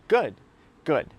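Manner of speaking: "Good" punched out alone, then repeated after a beat; emphatic "Good". Acted. To himself on waking up exhausted, emphatic "Good". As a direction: hit each "Good" hard as a one-word resolve. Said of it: "emphasis on the word good".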